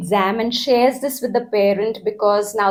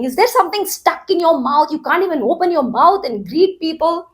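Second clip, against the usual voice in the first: higher, quick, scornful scolding; agitated mocking parent; 7 semitones higher, faster than usual. Parent scolding child who failed to greet neighbor, scornful. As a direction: snap at the child for not greeting. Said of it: "gets agitated all over again".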